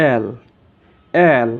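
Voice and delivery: "এল" with an open ae vowel; corrected letter L. Shown as a demonstration. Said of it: "কিন্তু এটা হবে কি?". ("এল" খোলা অ্যা-ধ্বনিতে)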